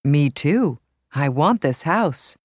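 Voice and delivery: normal talking voice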